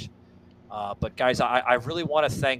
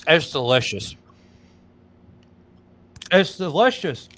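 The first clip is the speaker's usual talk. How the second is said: chewing while talking; talking through a mouthful of bar; mouth full; 3 semitones higher, 7 dB louder than usual. Real, not acted. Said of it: "talking with his mouth full on air".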